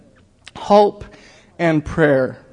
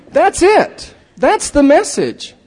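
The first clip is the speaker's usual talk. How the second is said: higher, louder, slowed preaching; fervent preaching; 10 semitones higher, 5 dB louder, slower than usual. Real, not acted. Preacher telling the congregation his whole message, fervent. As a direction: proclaim it with full conviction.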